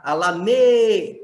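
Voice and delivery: "Alame" cut before da, last syllable loud and held; stress on ME. Shown as a demonstration. Teacher showing strongest syllable, loud long stress on "me". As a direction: punch and stretch the "me" in "Alame". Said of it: "que é a sílaba mais forte".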